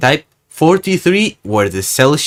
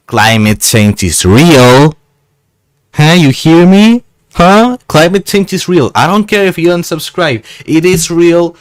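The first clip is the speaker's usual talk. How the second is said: raised, louder, higher-pitched insistence; emphatic insistence; 4 semitones higher, 5 dB louder than usual. Real, not acted. Vlogger insisting to doubters that climate change is real, loud and emphatic. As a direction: drive it home to a doubting audience.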